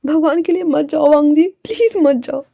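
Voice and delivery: high-pitched begging; desperate pleading. Acted. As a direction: implore someone not to leave. Fei begs Wangji to stay.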